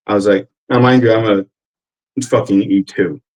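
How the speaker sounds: ordinary delivery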